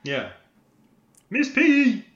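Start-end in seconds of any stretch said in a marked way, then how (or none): 1.3-2.0 Miss Piggy voice. Pretend. Suggesting impressions to do.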